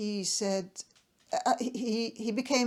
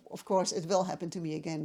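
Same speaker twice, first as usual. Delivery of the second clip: usual speaking voice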